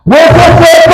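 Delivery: loud, high-pitched full shout; prophet's shout. Acted. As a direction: shout it out to wake the dead. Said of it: "empieza el profeta a gritar". (grito potente y agudo de profeta)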